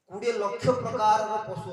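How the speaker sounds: loud, forceful announcement; emphatic proclamation. Real, not acted. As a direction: hammer the count home to the listeners. Preacher drives the number home. (ଉଚ୍ଚ ସ୍ୱରରେ ଜୋର ଦେଇ କହିବା)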